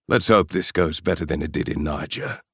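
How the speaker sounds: put-on a strong South African accent, grim and unsmiling; mercenary speaks in a heavy South African accent. Mercenary leader greets the Chinese spy coldly, South African accent. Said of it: "With a strong South African accent".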